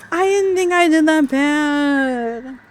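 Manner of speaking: high, loud, slow disbelief; indignant. Real, not acted. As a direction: protest the defeat. Reacting to losing.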